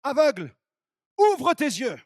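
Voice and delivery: loud, high, commanding call; a booming divine command to the blind; voice of God. Acted. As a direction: proclaim it like a divine order. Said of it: "j'entends la voix de Dieu qui me dit".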